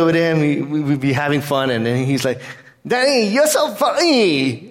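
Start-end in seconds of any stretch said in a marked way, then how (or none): 2.9-4.7 put-on high, drawn-out toddler squeal, dropping the n in funny; gleeful little kid still learning to talk; small child. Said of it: "his speech was being developed".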